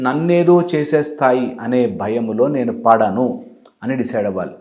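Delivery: ordinary talk, nothing marked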